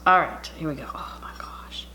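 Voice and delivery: hushed whisper at the food. Real, not acted. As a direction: whisper so you don't disturb anyone.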